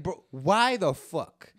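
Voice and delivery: slow, loud, incredulous bro; mock exasperation. Comic to the audience guy, slow loud mock outrage. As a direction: drag it out in disbelief.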